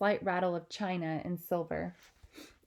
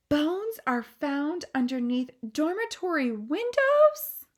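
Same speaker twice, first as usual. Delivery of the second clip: higher, louder, gasping between lines; aghast reading; 10 semitones higher, 6 dB louder, slower than usual. Acted. Queen reading the newspaper aloud, aghast. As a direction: read the horror out disbelieving.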